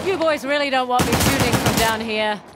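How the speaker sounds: loud mocking taunt; taunting shout. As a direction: yell a threat up the hill. Taunting enemies in fight.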